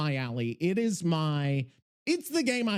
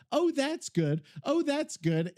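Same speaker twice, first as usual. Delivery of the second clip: higher, sing-song repeat of oh that's good; lightly hammed self-imitation; mock pleased self-quote; 8 semitones higher than usual. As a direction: imitate himself cooing approval. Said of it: "You hear me say".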